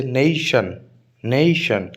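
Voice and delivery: Nation with a long, drawn-out first syllable "नई"; stress on first syllable. Shown as a demonstration. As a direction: slowly lean on the "ने" in nation, letting "शन" fall. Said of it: "नई पर stress आएगा". (Nation में पहले हिस्से "ने" पर खींचकर ज़ोर)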